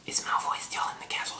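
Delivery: gasp, then whispered question; acted secret whisper. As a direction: whisper it urgently in imitation. Malfoy's mom secretly asking Harry.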